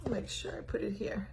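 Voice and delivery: soft whispered mutter, barely voiced; whispered aside. Real, not acted. Host whispering to herself while resetting phone.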